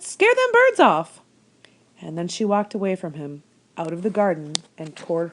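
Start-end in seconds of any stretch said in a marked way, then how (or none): 0.0-1.2 put-on much higher, louder old woman calling out. A narrator's reading. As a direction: holler it at the rabbit like a farm wife. Old woman ordering rabbit.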